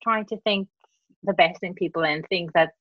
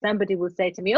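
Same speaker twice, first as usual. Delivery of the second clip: ordinary talk, nothing marked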